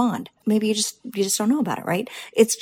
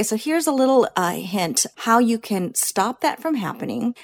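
ordinary talk, nothing marked

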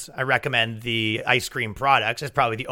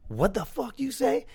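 high, soft, slow imitation of a challenge; mock confrontation; 7 semitones higher, 7 dB quieter than usual. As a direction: act out an offended comeback in a small high voice. Retold argument, younger lady snaps back.

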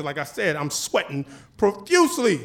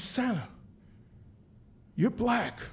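put-on hushed child; 9 dB quieter, slower than usual. Child's quiet discovery.

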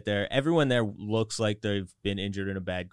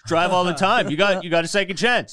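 higher, louder hammed-up bro; mock party bro encouraging drunk driving; 7 semitones higher, 6 dB louder than usual. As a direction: parody the reckless friend's advice. Mocking friend's drunk-driving logic.

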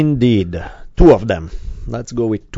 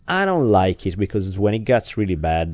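usual speaking voice

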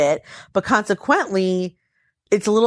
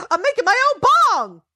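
much higher and louder, gleeful; excited husband; 13 semitones higher, 4 dB louder than usual. Retold or imitated. Husband proudly telling his wife about his homemade bong, gleeful and high. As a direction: play him like a kid showing off a toy. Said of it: "he's all excited".

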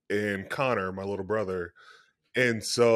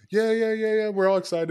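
high, eager imitation of brother; retold giddy agreement; excited; 10 semitones higher than usual. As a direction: rattle off the yeahs up high.

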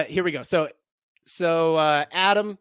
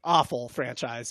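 ordinary talk

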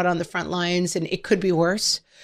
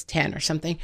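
usual speaking voice

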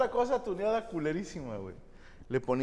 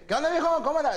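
higher, louder swaggering street greeting; Sinaloa kid; 10 semitones higher, 6 dB louder, faster than usual. Retold or imitated. Mocking a local kid faking Sinaloa slang, loud and high. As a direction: call it out like a cocky Sinaloa kid. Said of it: "Y de repente dice".

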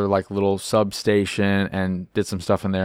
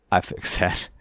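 laughing; 4 dB quieter than usual. Retold or imitated. Player reports fix, laughing.

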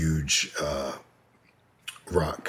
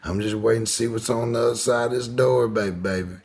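higher, put-on voice of his aunt, easy and calm; imitating aunt; 7 semitones higher, 3 dB louder than usual. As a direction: slip into her voice, unbothered. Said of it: "She's like".

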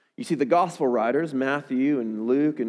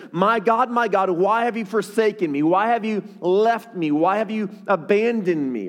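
loud, high cry of abandonment; Jesus' plea; 5 semitones higher, 4 dB louder than usual. Acted. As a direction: plead urgently as one forsaken. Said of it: "Jesus, the person speaking here, says".

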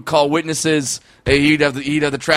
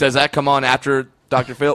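ordinary talk

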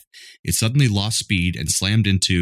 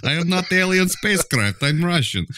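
much higher Swedish-chef style voice; hammed-up Nordic alien denial; mock Scandinavian accent; 11 semitones higher than usual. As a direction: insist you're Russian in a silly Nordic accent. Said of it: "That was a weird accent".

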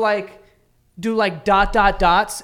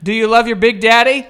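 louder, teasing Big Daddy voice; mock dad; 4 semitones higher, 10 dB louder than usual. Teasing his friend as the dad, loud and mocking. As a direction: ham up the creepy dad. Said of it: "mocking dad".

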